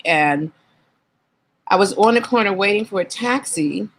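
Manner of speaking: ordinary talk, nothing marked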